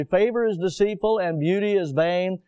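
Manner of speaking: measured reading of King James verses; solemn scripture reading. Acted. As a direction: read the verse with weight. Reads scripture aloud.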